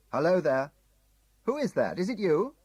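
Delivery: loud high hail across the distance; shouted. Acted. Tim shouting to a figure on the hilltop, loud. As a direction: cup your hands and shout it.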